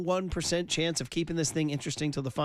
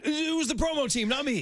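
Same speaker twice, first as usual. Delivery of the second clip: high mock quote; 11 semitones higher than usual. Tony's text read in mock voice.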